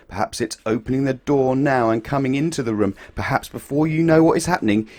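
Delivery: plain reading voice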